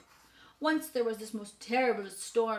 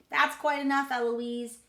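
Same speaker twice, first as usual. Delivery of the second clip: loud, higher parroting; child mimicking tutor; 4 semitones higher, 7 dB louder than usual. A narrator's reading. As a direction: throw the tutor's scolding back loudly. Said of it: "And I say".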